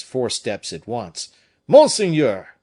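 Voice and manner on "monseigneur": loud, high urgent cry; alarmed shout. A narrator's reading. Gourville bursts in, shouting for his master.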